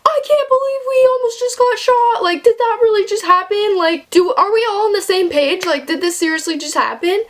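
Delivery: high panicked disbelief; breathless shock, voice jumping high; freaked out. Retold or imitated. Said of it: "we're freaking out, dude. Like, we are like".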